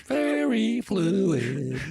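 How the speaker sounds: high, drawn-out singsong; high, floaty fairy chant; high register. Pretend. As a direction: sing it slowly up in fairy falsetto. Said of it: "your vocal register".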